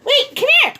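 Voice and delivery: high excited call. Real, not acted. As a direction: call him over, thrilled. Partner arrives home.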